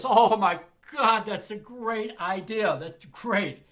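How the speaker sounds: hammed-up ecstatic owner; overjoyed club owner. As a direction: blow the roof off with joy. Paul loves the twist idea.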